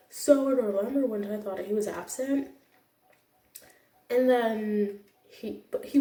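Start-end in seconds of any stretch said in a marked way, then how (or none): none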